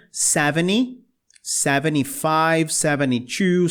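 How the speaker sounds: seventy as seveni, no T; T dropped. Shown as a demonstration. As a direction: drop the T after N in seventy. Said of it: "o T desaparece". (seventy sem o T)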